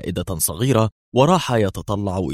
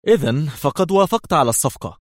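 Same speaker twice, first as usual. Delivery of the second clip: mocking smile; 7 semitones higher than usual. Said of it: "قالت بابتسامة ساخرة".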